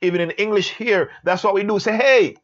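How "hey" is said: louder, higher attention-getting call. Shown as a demonstration. Teacher demonstrating English attention call, calling out. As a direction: hail someone with a raised voice. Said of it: "calling out to get your attention".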